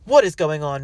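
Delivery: loud, sharp demand. A narrator's reading. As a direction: insist on an answer.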